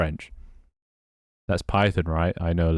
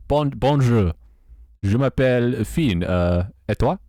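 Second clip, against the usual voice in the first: French accent; 4 semitones higher than usual. Shown as a demonstration. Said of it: "your French speaking accent is pretty good".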